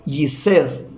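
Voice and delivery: Gised said as the English "Jesus", "jee-zus"; English pronunciation. Shown as a demonstration. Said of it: "pero en inglés".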